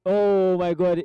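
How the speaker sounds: loud, drawn-out exclamation; commentator's loud gasp of disbelief in words; excited reaction. Real, not acted. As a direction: let it burst out over the play.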